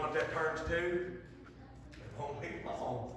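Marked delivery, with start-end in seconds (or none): none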